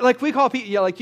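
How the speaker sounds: ordinary talk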